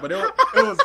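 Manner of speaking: high and loud through laughter; embarrassed laughing reveal of the anime. Real, not acted. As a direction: blurt it out cracking up.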